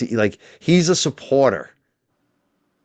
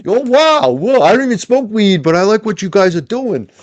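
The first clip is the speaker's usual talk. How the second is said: higher and louder, amazed; surprised impression; 12 semitones higher, 6 dB louder than usual. Friend recalls JFK realising who he is, higher amazed voice. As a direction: play his friend suddenly getting it, wide-eyed. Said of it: "He's like".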